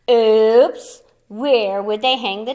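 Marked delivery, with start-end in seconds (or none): 0.1-1.0 comic oops. A narrator's reading.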